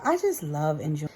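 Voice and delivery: ordinary delivery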